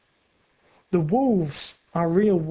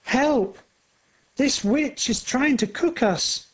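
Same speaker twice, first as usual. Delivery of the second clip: high cry for help; two frightened kids calling out; children's scream; 7 semitones higher than usual. A narrator's reading. Hansel and Gretel cry out at the witch, high and scared. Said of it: "scream together".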